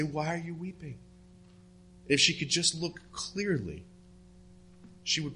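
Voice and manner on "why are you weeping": a hint of the angels, softer and hushed; softly voiced angels. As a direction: ask her gently, as the angels. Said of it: "They say".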